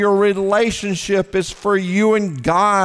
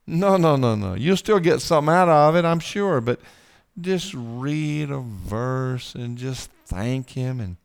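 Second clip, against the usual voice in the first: ordinary delivery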